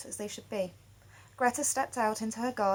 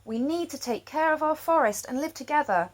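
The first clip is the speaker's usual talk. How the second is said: pleading question; 6 semitones higher, 4 dB louder than usual. A narrator's reading. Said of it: "they asked".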